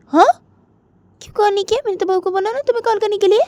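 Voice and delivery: lightly voiced mother. Jiya's mother on the phone, mildly exasperated. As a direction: explain with mild annoyance.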